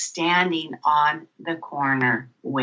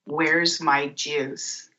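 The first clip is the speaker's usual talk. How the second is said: slow wondering question; 4 semitones lower, much slower than usual. Retold or imitated. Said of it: "I'm sitting there and go".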